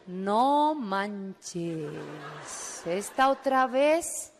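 slow, drawn-out dismay; exasperated disbelief. Retold or imitated. She hears the recruiter calling, slow disbelief. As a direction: drag it out in mock despair. Her dismayed reaction to the call.

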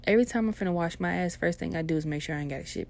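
ordinary talk

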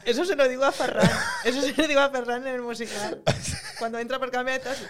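talking through laughter; laughing. Real, not acted. Telling a friend about a stage joke, laughing. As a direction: say it while laughing.

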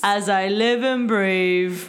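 imitating Lin-Manuel's bad Cockney, slow; slow mock Cockney drawl, hammed up; bad Cockney impression. Friends mocking a movie trailer line, hammed-up slow Cockney. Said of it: "he's like".

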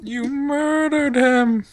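high, slow, loud wailing accusation; Paul Bearer. Acted. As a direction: wail it like a melodramatic ghoul. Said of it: "can I do a Paul Bearer?".

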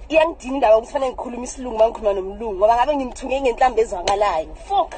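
loud, fast furious rant; angry rant. Real, not acted. Ranting to camera after fight.

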